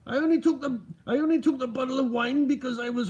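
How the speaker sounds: put-on high, loud, botched Scottish accent; badly faked Scottish voice, pitched well up; failed Scottish accent. Streamer impersonating someone and getting it wrong, badly faked Scottish accent. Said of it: "I can't do a Scottish accent".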